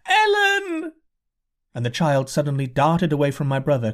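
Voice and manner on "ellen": high shriek with tears in it; tearful shriek. A narrator's reading. Mother searching the crowd for her child, tearful high shriek. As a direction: call out for a lost daughter. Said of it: "shrieked a woman in the crowd with tears in her voice".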